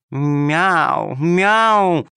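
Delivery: rising coaxing meow. Acted. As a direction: meow to lure the kittens in. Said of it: "замяукала вкрадчиво".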